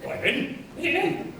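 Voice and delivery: high-pitched put-on sketch voice; Monty Python character. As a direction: keep up the comic falsetto reply. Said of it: "chased the rabbit of Monty Python".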